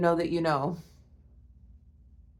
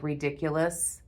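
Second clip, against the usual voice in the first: ordinary talk, nothing marked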